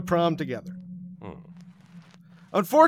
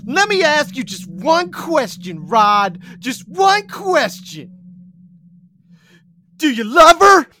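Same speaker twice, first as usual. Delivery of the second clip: a plea that builds from high to a cracking shout; louder and louder, voice breaking on "her"; rising emotional shout; 7 semitones higher, 8 dB louder than usual. Acted. Boy confronting his rival over Allie, a breaking shout.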